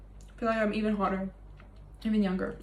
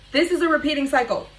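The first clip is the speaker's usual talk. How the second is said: emphatic realization; 5 semitones higher, 5 dB louder than usual. Real, not acted.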